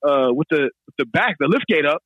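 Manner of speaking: ordinary talk, nothing marked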